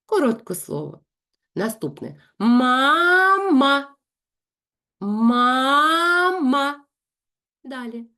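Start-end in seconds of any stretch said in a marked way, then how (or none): 2.4-6.8 «Ма-ма» loud, high, drawn apart; syllables separated. Shown as a demonstration. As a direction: split «Мама» into syllables. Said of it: "інтонаційно розділяючи склади". (по складах, голосно «Ма-ма»)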